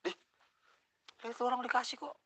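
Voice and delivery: high, loud indignant protest; angry retort. Retold or imitated. Customer protesting to the cashier, high and loud indignation. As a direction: snap back in disbelief. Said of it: "Gue langsung emosi".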